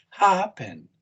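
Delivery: "Happen" with weight on HAP; stress on first syllable. Shown as a demonstration. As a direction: stretch the first syllable of "Happen", let the second drop. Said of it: "First syllable stress".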